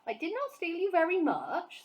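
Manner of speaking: lightly voiced big friendly giant, rustic; a hint of a kindly giant grumbling; BFG voice. A narrator's reading. BFG defensive.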